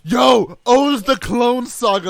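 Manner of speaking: loud, slow hype shout; hyped shout. Real, not acted. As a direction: announce it like a hype trailer. Hyped announcement.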